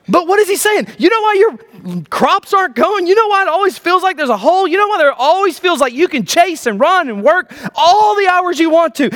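high-pitched, driving preacher's delivery; impassioned preaching. Real, not acted. Preacher pressing the congregation on why work never pays off, impassioned. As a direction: preach it in an urgent rush of questions.

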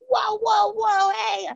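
high calling out whoa to stop them; retold shout. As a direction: hurry over calling them off. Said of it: "And she's like".

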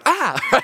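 high, loud imitated cry; mock startled yelp. Stranger accosted by a praying girl, mock startled yelp. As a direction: yelp as if ambushed, played for laughs.

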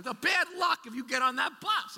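high, loud panicked yell; mother's scream. Retold or imitated. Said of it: "she's screaming at me".

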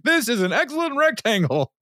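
high mock awe at a gadget; doing the Ron line. Shown as a demonstration. Host quoting Ron about his phone, high-pitched mock awe. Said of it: "I say that, like, all the time".

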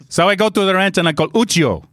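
put-on Italian storyteller; Italian accent. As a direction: spin the tale in mock Italian English. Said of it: "try and do an Italian accent".

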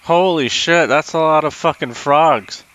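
gawking at too many frogs; drunk amazement. Acted. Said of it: "I'm like".

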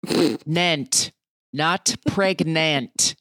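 "pregnant" with a full, drawn-out "-nant"; precise pronunciation. Shown as a demonstration. Host corrects actors who mispronounce a word, pedantic precise pronunciation. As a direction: slowly sound out "pregnant", keeping every consonant. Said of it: "learn how to pronounce this word".

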